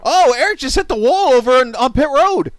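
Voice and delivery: high, loud sudden call; excited shout. Real, not acted. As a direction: call it out in alarm. Crash call on pit road.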